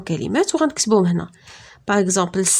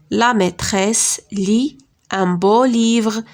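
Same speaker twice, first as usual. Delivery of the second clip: slow model reading; slower than usual. Shown as a demonstration.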